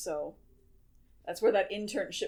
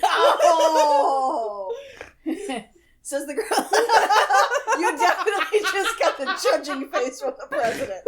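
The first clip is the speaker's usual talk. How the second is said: laughing; 8 semitones higher, 8 dB louder than usual. Real, not acted. Bursting out laughing.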